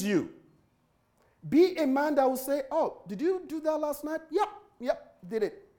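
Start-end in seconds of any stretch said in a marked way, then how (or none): 2.7-5.7 soft, higher-pitched question and quick yep; casual exchange. Acted. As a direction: act out a brief, matter-of-fact answer. Acts out a simple honest answer.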